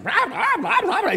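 high babbling blah blah; mock rant. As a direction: parody a ranting voice. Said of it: "it's like".

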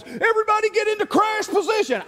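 high, urgent mock captain order; panicked pilot. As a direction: yell it like the pilot is losing it. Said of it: "he goes".

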